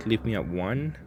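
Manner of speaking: usual speaking voice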